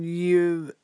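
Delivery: teasing, halting reveal; drawn-out hesitation. Acted. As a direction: stall grandly, then land the insult. Host delivers blunt verdict.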